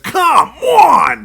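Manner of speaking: put-on loud, high Philadelphia accent yell of "Come on!". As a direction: bellow it like a frustrated Philly broadcaster. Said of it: "with that Philadelphia accent".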